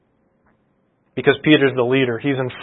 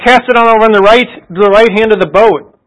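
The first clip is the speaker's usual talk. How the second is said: higher, mildly raised call; retold calling out; 6 semitones higher, 3 dB louder than usual. Stranger on the beach yelling to fishermen in their boat, retold calling out. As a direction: imitate a stranger hollering advice from a distance. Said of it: "The stranger yells out".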